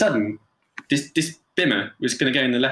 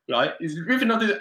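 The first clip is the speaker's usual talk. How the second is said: ordinary talk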